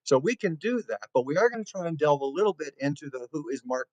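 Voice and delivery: ordinary talk, nothing marked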